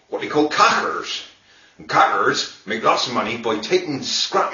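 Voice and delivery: broad rural Dorset accent. Shown as a demonstration. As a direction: speak proper Dorset, West Country style. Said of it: "real nice Dorset accent".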